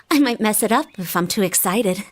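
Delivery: ordinary talk, nothing marked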